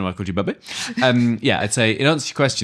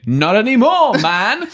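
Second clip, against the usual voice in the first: high, loud cheeky comeback; mock retort; 13 semitones higher, 7 dB louder, slower than usual. Student retorts to lecturer, mock cheeky and loud. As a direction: fire back a lightly voiced cheeky student.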